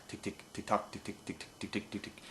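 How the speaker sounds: soft voiceless rapid ticking; tongue the ticks under breath; whispered ticks. Shown as a demonstration. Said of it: "Once you get that tick tock".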